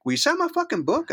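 very high, starstruck plea; excited retold request. Actor asking author to sign his book, thrilled. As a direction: imitate a gushing fan.

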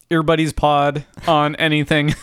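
stiff mechanical voice; robot voice. Pretend. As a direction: act the robot to the end. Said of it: "robot voice".

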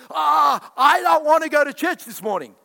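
high, loud groaning whine about church; hammed-up lazy complaint; loud mock groan. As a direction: moan it like a reluctant churchgoer.

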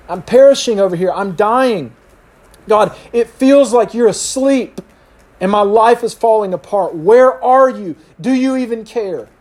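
desperate plea. Acted. Believer asking a sleeping God for help, desperate plea. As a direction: plead as if God won't wake.

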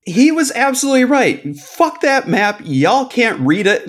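slow, hammered-out insistence; emphatic. Real, not acted. Passionately defending Mike.

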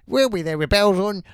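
a hint of the cheery sweep. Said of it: "He's nailed the catchphrase".

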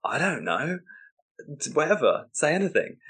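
high, swooping helpless answer; exasperated reply. Acted. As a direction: give up and plead for anything. Exasperated host answers clueless guest.

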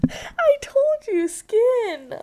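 giggly shocked disgust; disgusted laughter. Real, not acted. Reacting to gross picture.

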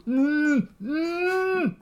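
high strained mm sounds; straining groan. Acted. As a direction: struggle to get words out. Metallic man straining to talk.

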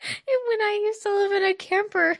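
laughing. Real, not acted.

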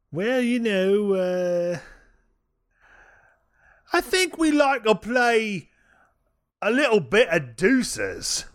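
slow, high mock Cockney; put-on Cockney, pondering at length; Cockney accent. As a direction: draw out a hammed-up Cockney answer. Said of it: "answer this in a Cockney accent".

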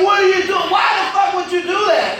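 high, indignant shouted questions; exasperated scolding. Retold or imitated. Uncle scolds his niece for pecking his forehead, exasperated.

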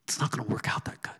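quick whisper; whispered aside. Real, not acted. A preacher confiding to his listeners, in a whisper. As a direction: let it slip out as a fast whisper.

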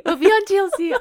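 high, loud excited echo; excited squeal. Real, not acted. Friend echoing the TV joke in excited glee. Friend echoes, much higher.

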